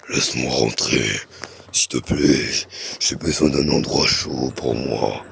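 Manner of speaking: very deep pleading monster voice; spider voice. Acted. Spider begging at the window.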